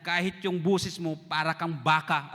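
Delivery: slow, deliberate gentle jab; slow, drawn-out friendly tease; slow teasing. Retold or imitated. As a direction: drag it out kindly. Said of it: "Sabi niya".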